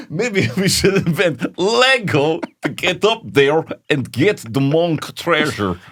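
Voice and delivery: high, slow laughing plan; mock Viking plotting through a laugh. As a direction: play along as a giggling Viking.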